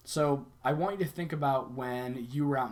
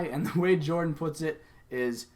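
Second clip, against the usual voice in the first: ordinary talk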